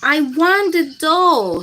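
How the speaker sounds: put-on a girl insisting loudly on the doll; child's loud demand; shout. A narrator's reading. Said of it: "shouts Sarah".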